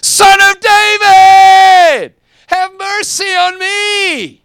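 long wailing plea to be heard over a crowd; desperate shout. Retold or imitated. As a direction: beg at the top of your lungs from the roadside. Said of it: "yelling out".

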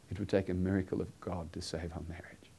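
soft unvoiced whisper of despair. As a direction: whisper it as a last hope. Retells the man's words to wife.

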